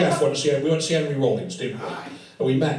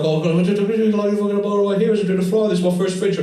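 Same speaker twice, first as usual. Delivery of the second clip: high, loud, rushed babble; gushing fan pitch; 8 semitones higher, 7 dB louder, much faster than usual. Retold or imitated. Young filmmaker pitching to his hero, retold as gushing babble. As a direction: blurt it all out in one excited rush.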